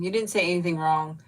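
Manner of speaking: usual speaking voice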